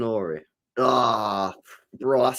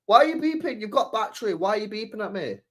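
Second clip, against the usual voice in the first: much higher, whiny complaint; high-pitched annoyance; 8 semitones higher than usual. Real, not acted. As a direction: whine up at the headphones. Annoyed at headphones.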